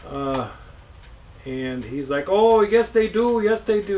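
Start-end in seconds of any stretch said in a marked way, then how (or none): 2.3-4.0 a light, higher, slow mock Indian accent as the scam caller; half-hearted Indian accent impression, drawn out. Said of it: "with Indian accent or what have you".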